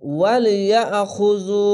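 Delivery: ওয়ালিয়াখুযু slow, vowel held one beat; one-beat stretch. Shown as a demonstration. Teacher reciting for the class, slow drawn vowel. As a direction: read ওয়ালিয়াখুযু slowly and stretched. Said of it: "এক আলিফ টেনে পড়বেন".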